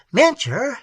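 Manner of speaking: high exclamation of shocked recognition; sudden high-pitched outburst; startled cry. A narrator's reading. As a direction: cry out the name in astonishment. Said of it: "he cried".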